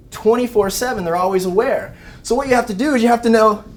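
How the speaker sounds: ordinary delivery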